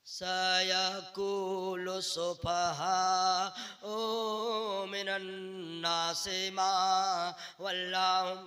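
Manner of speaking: slow sing-song mock recitation; mocking bad recitation. Preacher mocks the wrong way people recite, slow mock chant. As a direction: parody a careless reciter, dragging it. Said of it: "Quran कहीं ऐसे पढ़ा जाता है?".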